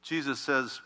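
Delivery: ordinary talk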